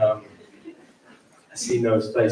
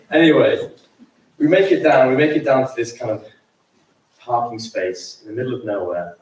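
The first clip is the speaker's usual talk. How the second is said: normal talking voice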